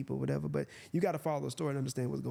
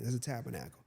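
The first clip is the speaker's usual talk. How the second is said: ordinary delivery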